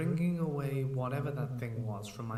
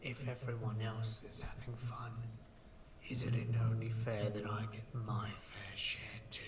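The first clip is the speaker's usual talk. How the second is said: sinister whisper; 4 dB quieter than usual. Acted. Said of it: "spoke in my voice".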